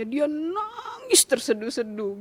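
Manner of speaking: tiruan tangis yang memelas, pelan dan panjang; nada menangis tersedu. Retold or imitated. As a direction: ulur kata seperti hendak menangis. Menceritakan anaknya menangis tersedu. (menirukan tangis tersedu-sedu)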